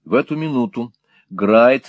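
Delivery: ordinary delivery, nothing marked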